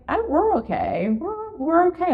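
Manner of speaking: high, bright forced reassurance; mock-cheerful self. To her knee after the fall, forced high cheer. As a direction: pretend everything is fine, up high. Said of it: "I was like".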